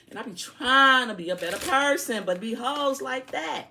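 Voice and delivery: high, loud exasperated complaint; exasperated outburst. Real, not acted. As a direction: throw up your hands and yell it high.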